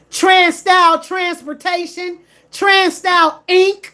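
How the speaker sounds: loud, slow, naming and shaming; calling out the name. Real, not acted. A driver loudly naming the company that ruined him.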